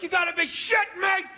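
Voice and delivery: high, outraged shout; screaming at the ref. Acted. Psycho dog owner watching the game, screaming at the ref. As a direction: yell it at the TV like an irate fan. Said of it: "you're screaming at the ref".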